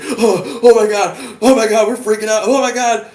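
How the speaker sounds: high, loud mock panic; freaking out. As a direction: imitate a spazzy friend losing it. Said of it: "he's, he's freaking out".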